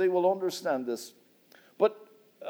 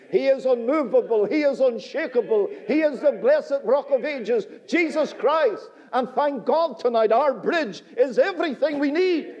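louder, higher triumphant proclamation; preaching at full force; 5 semitones higher, 5 dB louder than usual. Acted. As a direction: thunder out the good news.

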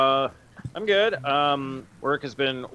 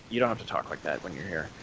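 clerk's reply; 8 semitones lower, 5 dB quieter, faster than usual. Retold or imitated. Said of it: "and he was like".

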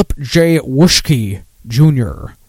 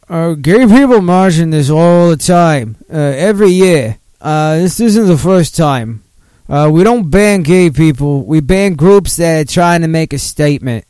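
slow, put-on Boston mayor accent; Mayor Menino accent; 3 semitones higher, 4 dB louder than usual. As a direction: lay on a bad Boston accent, drawn out. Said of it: "I could do a Mayor Menino accent".

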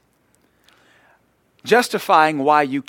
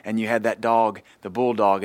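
ordinary talk

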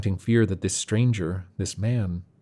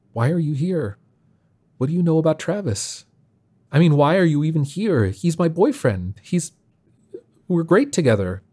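high, insistent questioning that gets louder; upset, defensive challenge; 8 semitones higher, 4 dB louder than usual. A narrator's reading. Shane defends the relationship with Travis, upset and defensive. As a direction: protest in a high voice, faltering before the last claim.

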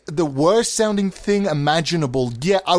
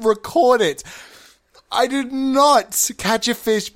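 high-pitched, hammed-up outrage; indignant bickering; 8 semitones higher than usual. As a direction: squabble back and forth in mock indignation. Acted quarrel with the letter writer.

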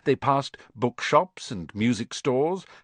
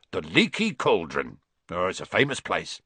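low, proud Hagrid voice; Hagrid proudly pointing out; 5 semitones lower than usual. A narrator's reading. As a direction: show off the pub like a big friendly giant. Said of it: "said Hagrid".

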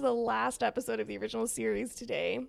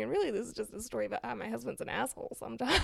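ordinary delivery

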